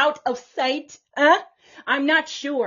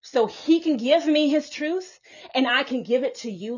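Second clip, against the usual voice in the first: ordinary talk, nothing marked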